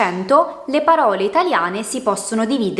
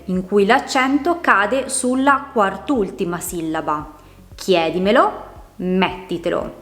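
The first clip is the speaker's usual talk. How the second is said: ordinary talk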